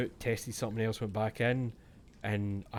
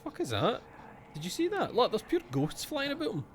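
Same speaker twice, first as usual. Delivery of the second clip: much higher, startled cry at ghosts; alarmed; 12 semitones higher than usual. Real, not acted. Gamer spotting ghosts on screen, startled high yelp.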